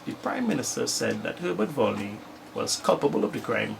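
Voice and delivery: normal talking voice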